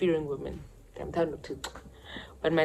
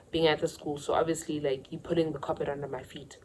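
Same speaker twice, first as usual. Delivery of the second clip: usual speaking voice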